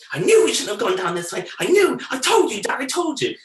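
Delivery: whiny told-you-so. Retold or imitated. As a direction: nag Dad like an indignant kid. Rob's told-you-so retold.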